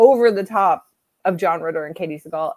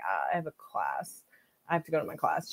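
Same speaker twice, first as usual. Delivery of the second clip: groggy reply; 3 semitones lower, 10 dB quieter than usual. Retold or imitated. Just woken, answering her roommate.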